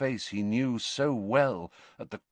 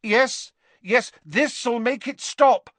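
high, louder urgent promise; hurried-sounding comfort in a raised voice; urgent assurance; 12 semitones higher, 5 dB louder, slower than usual. A narrator's reading. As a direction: soothe him with desperate insistence. Harry lies to soothe moaning Dumbledore.

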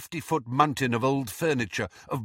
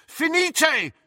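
high, urgent incantation; crying out a spell; 4 dB louder than usual. A narrator's reading. Boy steadying the falling wall, crying out. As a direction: cry the spell out sharply.

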